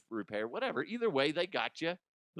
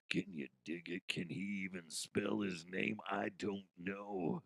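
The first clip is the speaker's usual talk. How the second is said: much softer, lower mock wrestler voice; a low hint of Macho Man; muttered Macho Man; 6 semitones lower, 9 dB quieter than usual.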